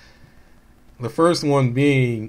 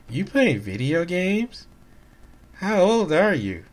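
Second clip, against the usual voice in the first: mock kid voice; 6 semitones higher, slower than usual. Voicing the eleven-year-old kid.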